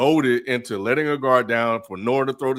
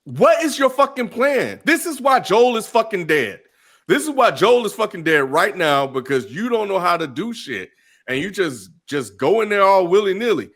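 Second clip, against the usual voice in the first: exasperated rant; 6 semitones higher than usual. Real, not acted. Ranting at the character.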